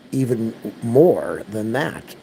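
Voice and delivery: normal talking voice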